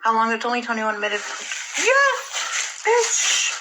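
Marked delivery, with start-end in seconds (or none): 1.8-3.5 high-pitched squeak; squeaky high "bitch". Pretend. Squeaky exclamation.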